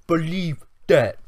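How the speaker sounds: put-on slow, half-hearted stab at Roman Reigns's accent on his catchphrase; mock the wrestler's accent, slowly, knowing it's off; attempted Roman Reigns accent. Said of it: "Can't even do the accent".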